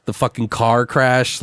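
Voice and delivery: usual speaking voice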